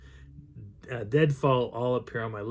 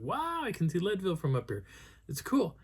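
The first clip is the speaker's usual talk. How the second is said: awed exclamation; 7 semitones higher, 4 dB quieter than usual. Retold or imitated. Hiker retelling his first sight of Leadville, awed.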